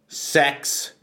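ordinary delivery

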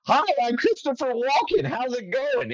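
badly faked impression in a high, goofy voice; Christopher Walken. Guest announcing a joke impression, absurdly high and wrong. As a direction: pitch it way up, deliberately nothing like him. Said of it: "I can do an impression of, uh, Christopher Walken".

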